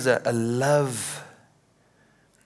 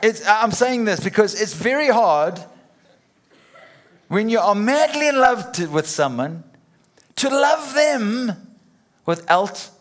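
much higher and louder, words bursting through laughter; laughing loudly; 9 semitones higher, 8 dB louder than usual. Real, not acted. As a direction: push on while laughing helplessly.

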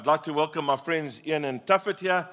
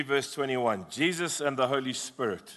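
ordinary delivery

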